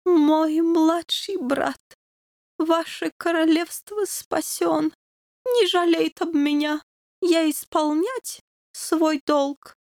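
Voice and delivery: slow, high dying dog king voice; fading noble farewell, foreign accent. A narrator's reading. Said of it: "говорил тихо-тихо". (умирающий король тихо прощается)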